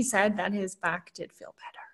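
soft whispered aside; whispering. Real, not acted. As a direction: breathe it like a secret. Whispered aside about husband.

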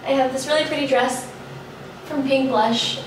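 ordinary talk

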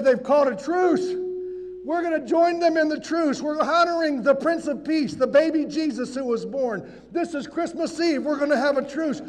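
loud, emphatic news of a truce; captain's announcement. Acted. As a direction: proclaim it to all your men. Captain announces truce to his men.

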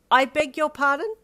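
high, loud outraged screech; scandalised shrill question. Acted. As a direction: shriek it in disbelief. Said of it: "you screeched at him".

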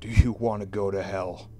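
loud, fierce menace. Acted. Mother chasing her son asks fiercely, loud. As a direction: threaten him hard. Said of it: "I asked fiercely".

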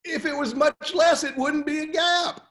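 amused, high laughing voice. Retold or imitated. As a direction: laugh it out in disbelief. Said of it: "I said".

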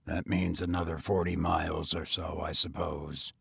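low, quiet grim muttering. A narrator's reading. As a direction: count the miles grimly to yourself. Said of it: "he continued grimly".

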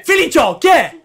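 usual speaking voice

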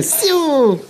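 high shouted Siu like Cristiano; Siu celebration cry. Acted.

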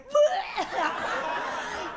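long hammed-up retch; mock vomiting. Bulimic woman after swallowing, mock vomiting. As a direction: throw up noisily, for comedy.

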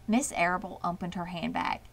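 ordinary delivery, nothing marked

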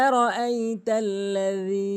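আরাআইতাল্লাযী, tongue tip on teeth for the last consonant; correct tajweed. Shown as a demonstration. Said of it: "এভাবে উচ্চারণ করতে হবে". (আরাআইতাল্লাযী, নরম যাল টেনে)

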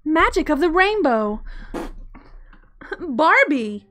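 high, loud Barbie voice. Pretend. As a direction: squeal it like a Barbie doll. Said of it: "Have you unlocked a Barbie voice?".

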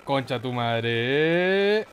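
ordinary delivery

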